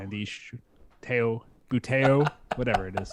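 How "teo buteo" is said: "teo, buteo" said slowly as TAY-oh, boo-TAY-oh; "buteo" drawn out, boo-TAY-oh; trying out pronunciation. Shown as a demonstration. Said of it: "however you wanna pronounce that".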